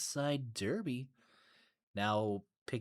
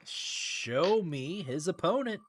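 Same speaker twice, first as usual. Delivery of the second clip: slow, high drawn-out call; showy announcer; 7 semitones higher, 3 dB louder, slower than usual. Real, not acted. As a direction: ham up the call for a challenger. Playful announcer calling for next name.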